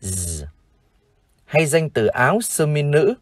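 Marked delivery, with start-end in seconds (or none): 0.0-0.4 "z" as a long voiced buzz; isolated z sound. Shown as a demonstration. Teacher shows viewers the final sound, "z" buzzed alone. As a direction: hold "z" buzzing, with no vowel. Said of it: "âm cuối chúng ta đọc là".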